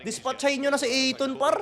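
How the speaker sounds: high, loud fan outburst; heated sports-watching rant; exasperated rant. Real, not acted. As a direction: complain hotly about the player. Ranting at a player's defence.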